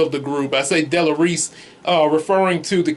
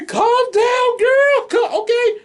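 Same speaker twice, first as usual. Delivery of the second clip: high squeaky voice. Retold or imitated. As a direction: squeak at her to chill out. Calming hyped friend in story.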